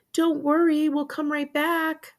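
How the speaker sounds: put-on high, little-child reassurance; high, drawn-out sweet assurance; small child comforting. A narrator's reading. Little sister comforting brother.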